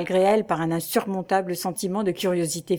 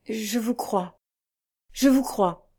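stammered; 3 semitones higher, slower than usual. A narrator's reading. Young woman to the priest who gives her hope, faltering. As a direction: falter it out, lightly overcome. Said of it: "balbutia la jeune fille".

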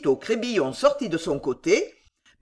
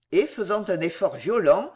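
ordinary delivery, nothing marked

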